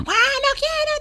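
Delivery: put-on high, whining toddler refusing; whiny child. Teacher imitating a crying toddler at the door, high and whiny. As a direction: squeak it like a sulky little kid.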